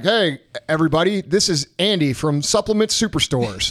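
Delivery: ordinary talk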